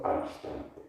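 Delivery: "al instante" soft, nearly whispered; hushed key phrase. Shown as a demonstration. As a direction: let "al instante" drop to a hush. Same key phrase repeated softly.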